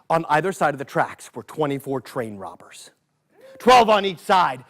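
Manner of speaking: plain reading voice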